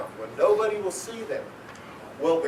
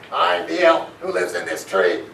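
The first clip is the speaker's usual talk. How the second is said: put-on lower, grumpy old elf voice; irritated elf; 5 semitones lower than usual. Woken old elf tells the kids who he is, irritated. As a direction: snap it like a cranky old elf. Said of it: "he said in irritation".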